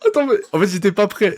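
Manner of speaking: high, startled burst of surprise; jolted by what you just heard; real surprise cracking up high; caught off guard. Real, not acted.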